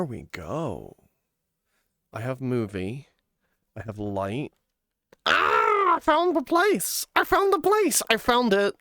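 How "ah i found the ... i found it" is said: high, loud triumphant cry; excited shout. Real, not acted. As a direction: shout it out in delight. Excitedly announces finding the place.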